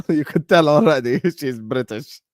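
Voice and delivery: talking through laughter; laughing. Real, not acted. Amused that Gemma is so obviously British, laughing. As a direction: laugh between the words.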